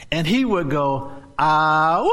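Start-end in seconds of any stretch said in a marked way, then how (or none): 1.4-2.1 imitating uncle's bellow, ah rising to woo; mock bellow, ah then woo; loud bellow. Retelling Uncle Harry opening gifts, bellowing. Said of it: "he will let out a loud bellow".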